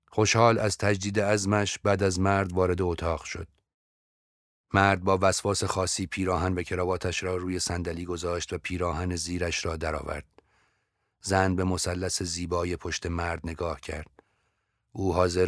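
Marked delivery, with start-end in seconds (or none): none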